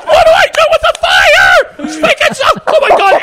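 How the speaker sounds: very high, loud comic panic; hammed-up frantic yelling; panicked screaming. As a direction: shriek helplessly as if everything is on fire. Acting out panic at the phone.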